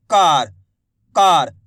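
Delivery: कार with the aa held long; careful pronunciation. Shown as a demonstration. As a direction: dictate कार clearly, stretching का before the r. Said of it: "शब्द को अच्छी तरीके से सुनो".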